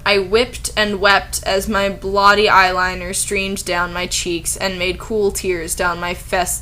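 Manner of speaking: ordinary talk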